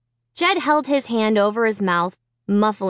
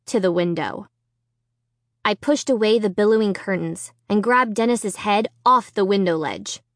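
usual speaking voice

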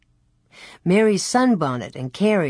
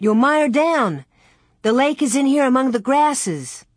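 high, louder warning; urgent cry; 7 semitones higher, 4 dB louder than usual. A narrator's reading. Laura warns Carrie she'll mire down, crying out urgently. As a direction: cry out to warn a younger sister. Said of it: "Laura cried".

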